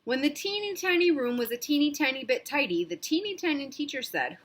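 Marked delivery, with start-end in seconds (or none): none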